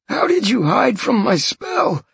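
high, pleading, frightened man; terrified captive. Acted. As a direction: swear it, panicking and in pain. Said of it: "the guy asked him suddenly".

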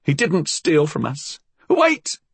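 put-on high-pitched old woman, flustered; protest, then cry out for a pause; high, panicky old-woman voice. A narrator's reading. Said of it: "she stuttered".

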